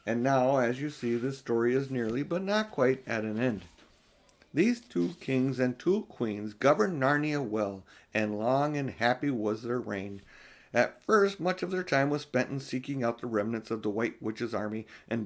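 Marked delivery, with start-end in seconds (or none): none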